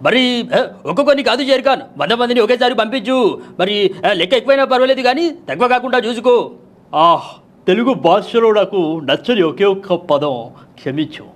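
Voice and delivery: high, emphatic film-dialogue voice; changed-voice mimicry. Host opens the show mimicking film dialogue, theatrical put-on voice. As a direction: put on a grand screen-hero voice, slowing for the last line. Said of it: "ఈరోజు గొంతు మార్చి మాట్లాడుతున్నాను".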